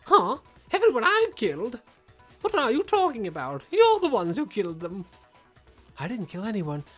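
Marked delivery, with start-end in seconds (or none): 0.0-5.0 loud, high, fake astonishment; mock surprise. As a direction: gasp at the accusation theatrically. Villain mock outrage.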